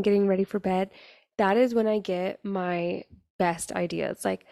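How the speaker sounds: ordinary talk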